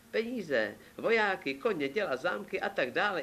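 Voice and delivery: high-pitched royal wish list; demanding king. Acted. King telling Ivánek what he wants, high and demanding.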